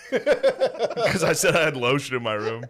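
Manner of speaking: slowed by laughter; laughing. Real, not acted. Son explaining the dinner story, laughing.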